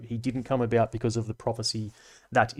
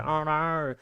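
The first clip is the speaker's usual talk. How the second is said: high, far-off Aussie "Oh, no."; Australian "oh no"; 5 semitones higher than usual. Shown as a demonstration. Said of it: "We say".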